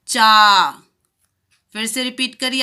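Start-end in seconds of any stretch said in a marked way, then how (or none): 0.1-0.7 चा with a long, loud aa. Shown as a demonstration. Teacher to children, reading चा with a long aa. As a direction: stretch the aa in चा. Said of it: "हम कैसे पढ़ेंगे?".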